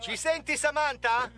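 loud high call upward; calling from far away. Pretend. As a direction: call up as if to a spacewoman. Ci senti da lassù. (chiamata a gran voce da lontano)

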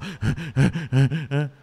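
fast audible gasping breaths; puffing breaths, acted out; demonstrated heavy panting. Shown as a demonstration. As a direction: imitate breathing hard, out of breath. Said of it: "a minha respiração tava assim".

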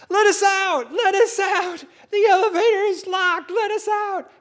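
high, loud yelling for rescue; panicked shout. Retold or imitated. Trapped man pounding elevator doors, high panicked shout. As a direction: scream to be let out, pounding the doors. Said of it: "he starts to pound on the doors".

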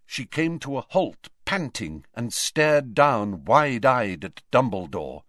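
usual speaking voice

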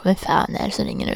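grumpy callee. Retold or imitated. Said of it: "För att de bara".

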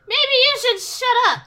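put-on drunken snap. Drunk character snapping back.

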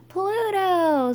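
very high, loud stretched cheer; screamed cheer. A narrator's reading. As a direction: top the last shout with a storybook scream. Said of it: "screamed the underdogs".

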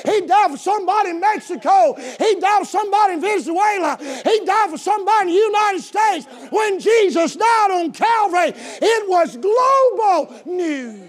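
loud, high sermon climax shout; full-force preaching. Real, not acted. Preacher to congregation on Jesus dying for the whole world, full-force preaching. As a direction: shout it out, each phrase driven hard.

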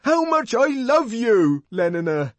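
high, loud, slow, desperate declaration; desperate confession. Acted. John confessing love to Lenina, desperately. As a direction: force the words out desperately. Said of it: "he brought out almost desperately".

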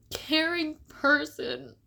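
high and slow, tears close; holding back tears. Real, not acted. [name] naming who she wants to be, near tears. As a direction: stretch the words as the tears come. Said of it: "I don't wanna cry right now".

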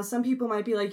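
ordinary delivery

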